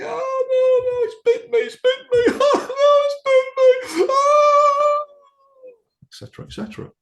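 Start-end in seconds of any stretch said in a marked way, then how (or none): 0.0-5.0 very high, loud, terrified shrieking; crying and screaming. Retold or imitated. Regressed client reliving a childhood dog bite, terrified screaming. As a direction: re-enact a toddler screaming in panic as the dog bites. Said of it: "she was crying and screaming".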